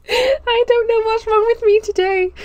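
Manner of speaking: high, slow, laughing almost to tears; can't stop giggling at her own pun; helpless laughter. Real, not acted. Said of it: "Someone stop me".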